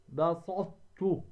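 slow "بسطت" as basaT-tu; emphatic ta merged into ta. Shown as a demonstration. Teacher demonstrating incomplete merging, slow بسطت with ط held unreleased. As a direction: hold the heavy ط, release only a light t. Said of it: "تدغم الطاء".

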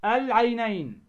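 "العينين" with the l of the article sounded; teacher's careful "العينين", lam not dropped; clear lam. Shown as a demonstration. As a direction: model "العينين", holding a distinct lam before ع. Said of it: "ما تقول عينين".

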